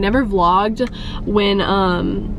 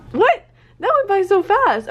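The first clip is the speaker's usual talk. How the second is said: shocked; 8 semitones higher than usual. Real, not acted. Shocked at how fast time went.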